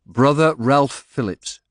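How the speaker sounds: put-on slow Northern English accent. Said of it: "His accent revealed him as a man of the North".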